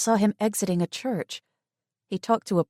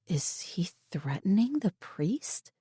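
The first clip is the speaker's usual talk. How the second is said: whisper; 5 dB quieter, slower than usual. Acted. Inner thought while spying.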